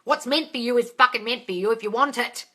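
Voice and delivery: normal talking voice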